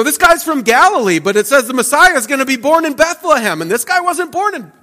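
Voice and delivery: high, excited crowd sure of themselves; mocking crowd voice. Preacher voicing the crowd doubting Jesus, high and smug. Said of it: "They go".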